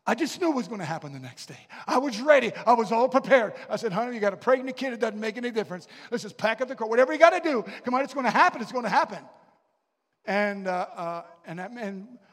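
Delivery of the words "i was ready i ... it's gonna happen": louder, higher, rushing urgency; a young husband urging his pregnant wife to pack the car, eager and loud; eager excitement. Retold or imitated. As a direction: pack up now, it's happening.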